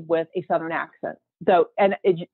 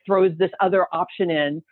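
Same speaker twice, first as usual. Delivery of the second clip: usual speaking voice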